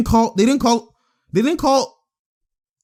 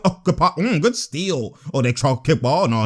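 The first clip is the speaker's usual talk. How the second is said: ordinary delivery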